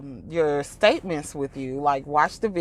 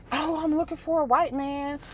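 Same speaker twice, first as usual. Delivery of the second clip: much higher, lightly mocking; mock eager woman; 10 semitones higher than usual. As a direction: play a man-hunting woman. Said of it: "It wasn't a".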